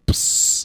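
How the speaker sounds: whispered psst like a spray can; spray hiss. Shown as a demonstration. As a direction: hiss out a quick spray sound. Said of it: "I'll just do it like".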